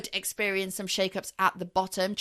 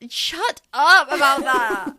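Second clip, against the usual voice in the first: slow, loud, playful mock protest; mock outrage; 7 semitones higher, 12 dB louder, slower than usual. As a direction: fake indignation, drawn out. Teased about supporting City.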